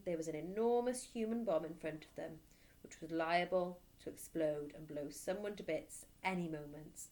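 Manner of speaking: usual speaking voice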